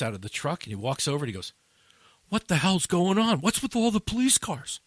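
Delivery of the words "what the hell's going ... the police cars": loud, raised-pitch garbage man; imitating someone loudly baffled; retold baffled outcry. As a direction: act out a bewildered demand.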